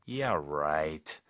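low, drawn-out sarcasm; skeptical, stretched "right"; sarcastic. Shown as a demonstration. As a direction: drop the pitch and doubt them. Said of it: "이 말투가 좀 약간 비꼬이는 톤이죠".

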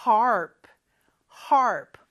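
"harp" with a clear English r before the p; loud, crisp model of "harp" with its r sounded. Shown as a demonstration. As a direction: put the r firmly into "harp". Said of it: "R 발음 잘 넣어주시고요".

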